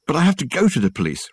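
a mild exclaimed protest; exclaiming. A narrator's reading. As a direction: push back urgently. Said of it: "Richard exclaimed".

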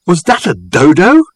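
loud, high-pitched astonished question; exclaiming. A narrator's reading. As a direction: exclaim it in amazement, pitch leaping up. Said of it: "he exclaimed".

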